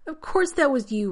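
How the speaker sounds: loud, high angry snap; snapped. A narrator's reading. Rodney snapping back at John, loud and angry. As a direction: bite his head off. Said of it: "Rodney snapped".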